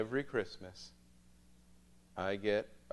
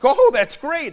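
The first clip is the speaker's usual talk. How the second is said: mock delight; 20 dB louder, slower than usual. Mock cheerful reply to niece.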